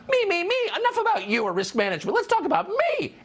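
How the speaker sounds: high, fast mocking me-me-me voice; mock her as a preening narcissist; mocking a self-absorbed woman. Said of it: "She talked mostly about herself".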